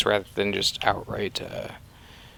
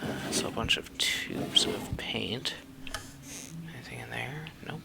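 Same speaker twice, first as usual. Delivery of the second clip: whispering; 11 dB quieter than usual. Real, not acted. Searching drawers in the dark.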